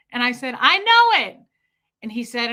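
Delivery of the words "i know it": high, loud reply to a deaf partner; loud retort. Retold or imitated. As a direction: call it back loudly. Shouted reply to hard-of-hearing Fred.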